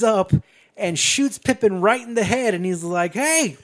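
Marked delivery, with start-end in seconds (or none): 3.1-3.6 high, loud gloating holler; hammed-up outlaw jeer, much higher and louder; taunting mock shout. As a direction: yell the taunt across a gunfight. Billy taunts enemy in shootout.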